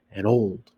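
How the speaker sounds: put-on An old run together low, neither word accented; An old as one weak beat; unstressed. Shown as a demonstration. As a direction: keep An old small and even. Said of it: "same unstressed moment".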